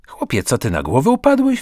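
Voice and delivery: rising to a high, loud disbelieving cry; incredulous exclamation. A narrator's reading. Zbyszek hearing Karol wants theatre, high astonished exclamation. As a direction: shout it like he's seen a madman. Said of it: "wykrzyknął".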